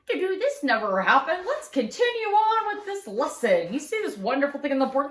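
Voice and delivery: hammed-up cheerful teacher moving on fast; breezy cover-up. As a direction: brush it off brightly, pretending nothing happened. Nun covering up the fire.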